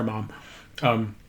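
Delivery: ordinary talk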